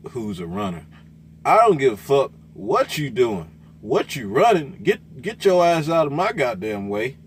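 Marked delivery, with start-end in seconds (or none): 1.4-7.2 loud, heated rant at other drivers; angry rant. Real, not acted. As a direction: bark it out, fed up. Ranting at other truck drivers.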